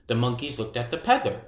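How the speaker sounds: ordinary delivery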